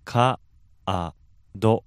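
カー held long then ド alone; syllable by syllable. Shown as a demonstration. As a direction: split カード into カー and ド with a pause. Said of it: "just break that down".

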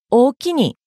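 おおきに with the accent landing on き. Shown as a demonstration. Teacher showing Kansai thanks to listeners, おおきに accented on き. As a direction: raise the き in おおきに, Kansai style. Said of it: "きにアクセント".

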